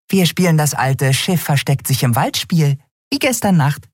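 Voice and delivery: high and impish; a little flier pleased with her plan; high, mischievous fairy voice; grinning tiny sprite. Acted. Nelen grinning.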